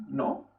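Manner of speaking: soft "¿no?" with a rising end; quiet questioning lift on "¿no?". Shown as a demonstration. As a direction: slide "¿no?" upward from low. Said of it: "Esta pregunta".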